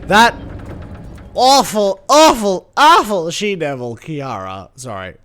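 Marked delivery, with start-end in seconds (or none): 0.0-4.0 rising, louder, hammed-up outrage; mock dramatic outrage. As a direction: build the insult theatrically. Mock-dramatic insult of a friend.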